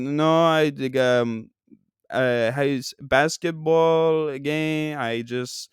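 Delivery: slow, halting fake European accent; stumbling Euro trash accent. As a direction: grope for words in a phony Euro accent. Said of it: "in my Euro trash accent".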